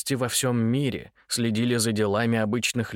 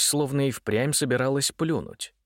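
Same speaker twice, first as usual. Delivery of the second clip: ordinary delivery, nothing marked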